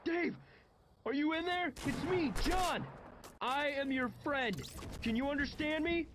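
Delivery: very high, strained yelling; screaming into someone's face. A narrator's reading. John grabs his friend's shoulders to reach him, screaming. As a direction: yell as if getting through to someone unreachable. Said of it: "screamed into my face".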